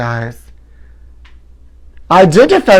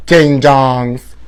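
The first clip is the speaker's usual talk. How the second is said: normal talking voice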